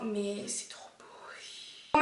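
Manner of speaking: slow, soft, gushing whisper; adoring whisper. Real, not acted. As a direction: whisper it slowly, melting over how pretty it is. Attendrie par sa décoration. (chuchoté, lentement, avec adoration)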